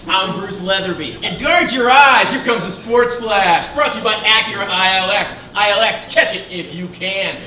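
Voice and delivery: big loud sports announcer, slowed. Acted. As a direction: boom it out like a TV sportscaster. Sportscaster intro announcing Sports Flash.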